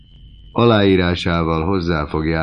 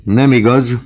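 higher, louder, cutting husband's retort; sharp denial; 8 semitones higher, 9 dB louder than usual. A narrator's reading. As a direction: cut her lie off hard. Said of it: "mondta átható pillantással".

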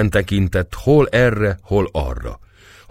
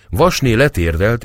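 ordinary delivery

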